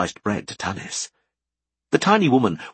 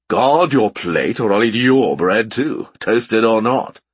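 louder, slow hearty man; big man's voice; 6 dB louder, slower than usual. A narrator's reading. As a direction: boom it out like a jovial giant. Thibaut jokes from other room.

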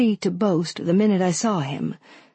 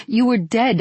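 higher, louder, rising distress; distressed disbelief; 6 semitones higher, 4 dB louder than usual. A narrator's reading. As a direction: insist it, voice climbing in panic.

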